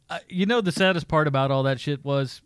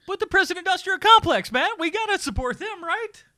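mock-earnest higher voice; sarcastic. Podcast host sarcastically backing the prison industry. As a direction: pretend to cheer for the prison industry.

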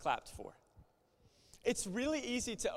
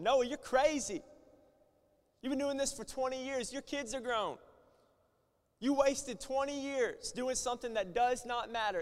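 mocking crowd; 6 semitones higher, 4 dB louder than usual. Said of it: "imagine what people were saying".

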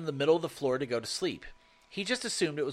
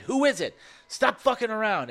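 higher mock yell through the door; retold yell; 7 semitones higher, 3 dB louder than usual. Kids in the cabin yelling at the door, mock scared yell. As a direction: call out scared and high, half-yelling. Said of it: "We keep yelling outside".

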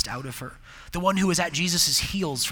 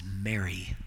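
tender calling; 8 semitones lower, 7 dB quieter than usual. Acted. Jesus calling Mary by name.

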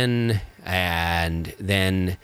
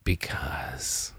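breathy secretive whisper; 7 semitones lower, 9 dB quieter, much slower than usual. Real, not acted. Playful evasive answer.

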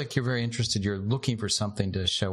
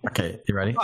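ordinary talk, nothing marked

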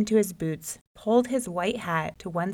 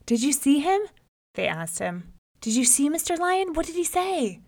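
eager questions; 8 semitones higher than usual. Acted. Folks eagerly question the rabbit.

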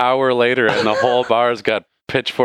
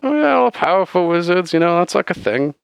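high-pitched, rambling barkeep; chatty bartender; 11 semitones higher than usual. Acted. Bartender explains wizards to adventurers, high chatty character voice.

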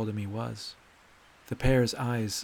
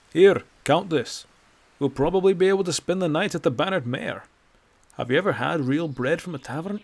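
put-on loud, higher, chatty old man voice; cheerful old Nord; 4 semitones higher, 6 dB louder than usual. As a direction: ramble happily about tavern bread. Bracknell chatting on the road.

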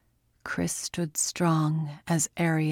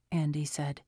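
usual speaking voice

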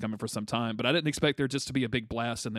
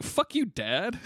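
high indignant retort; 9 semitones higher, much slower than usual. Retold or imitated. Retorting as Scott, high rise.

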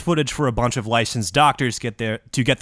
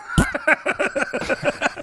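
blown raspberry; mock raspberry; 3 dB quieter than usual. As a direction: pffft through the lips. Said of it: "blow a quick comedic relief raspberry".

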